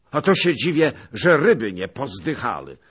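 loud, high, angry retort; a loud angry jab. A narrator's reading. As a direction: snap back at him in anger. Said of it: "rzekł z gniewem".

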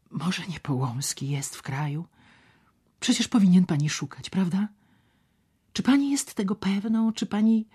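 a man anxiously questioning a woman; voiced anxious man. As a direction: press her nervously, half whispering. Szczerbic presses Ewa anxiously.

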